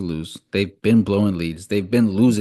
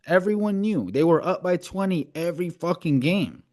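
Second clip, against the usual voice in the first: high-pitched, worked-up insistence; heated disbelief; 9 semitones higher than usual. Real, not acted. Podcast host arguing they should have won easily, heated disbelief. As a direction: argue it emphatically, pitch riding high. Heated argument over Celtics collapse.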